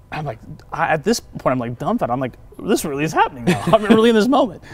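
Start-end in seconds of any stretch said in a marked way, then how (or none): 2.6-4.6 rising high and louder; overwhelmed, it's really happening; high, excited wonder; awed excitement. Retold or imitated. Awed realization on the phone.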